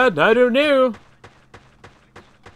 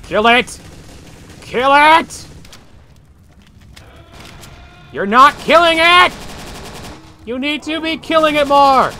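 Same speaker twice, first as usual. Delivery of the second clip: yelling; 11 semitones higher, 10 dB louder, slower than usual. Real, not acted. Yelling at teammates to kill.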